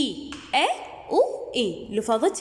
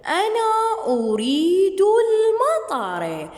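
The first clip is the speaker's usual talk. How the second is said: slow, sing-song reading with full vowel endings; expressive reading aloud; 3 semitones higher, much slower than usual. Shown as a demonstration. Teacher reading the rain lesson to her pupils, slow and sing-song. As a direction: read it slowly like a storybook for children.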